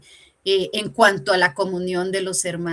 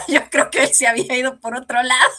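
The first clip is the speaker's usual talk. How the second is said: real laughter bubbling through the words; laughing; 5 semitones higher, 7 dB louder, faster than usual. Real, not acted. Woman telling her church testimony, laughing. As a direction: laugh as you say it, quick and high.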